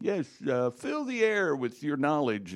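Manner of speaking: slow, put-on teacher impersonation; odd-talking coach. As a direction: draw it out as the oddball coach. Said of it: "Can you do his voice?".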